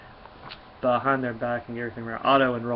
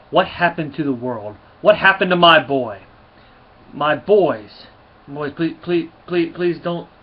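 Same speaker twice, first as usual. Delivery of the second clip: rising into a loud, higher desperate plea; losing it; 4 semitones higher, 9 dB louder, slower than usual. Acted. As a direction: let the pleading break out louder. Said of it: "he just sort of loses in this one".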